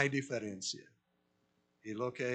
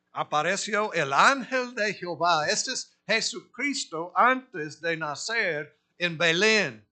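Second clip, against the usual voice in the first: loud, higher proclaiming of scripture; fervent preaching; 6 semitones higher, 10 dB louder than usual. Acted. Preacher proclaiming the burning bush to the congregation, loud and high.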